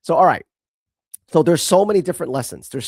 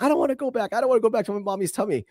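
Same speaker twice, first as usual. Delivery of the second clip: higher, fast imitation of an upset little girl; upset toddler; 8 semitones higher, much faster than usual. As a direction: play the pleading toddler, quick and high. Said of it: "she got a little bit upset".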